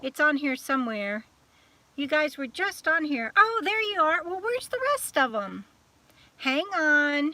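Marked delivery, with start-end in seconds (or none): none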